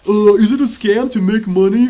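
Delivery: loud, sneering parody of a viewer; mocking chat question. Streamer parroting a viewer's question, loud mockery. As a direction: ham up the dumb question.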